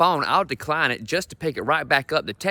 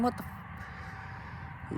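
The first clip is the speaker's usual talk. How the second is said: breathy cut-off whisper of a swear; whispered curse; 17 dB quieter, much slower than usual. Acted. Comic whispering his disbelief at getting old.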